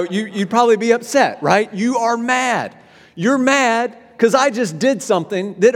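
high, loud, impassioned preaching; preaching at full force. Acted. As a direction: drive the story home from the pulpit. Preaching the car example.

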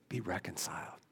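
breathed, whispered plea; whispered urging. Real, not acted. Preacher urging the congregation to reconcile, in a whisper. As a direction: let it fall to a whisper. Sermon point closing softly.

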